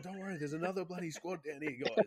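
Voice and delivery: high, put-on casual reassurance; mock reassurance. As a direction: fake a calm high call to the squad. Fooling the squad.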